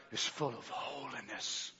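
soft and breathy, nearly whispered; hushed. Real, not acted. Preacher quietly praising God after shouting. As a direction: let it fall to a hush.

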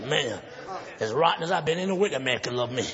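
low, subdued retold despair; hopeless. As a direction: drop the voice as if giving up. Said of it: "Yeah, this is hopeless".

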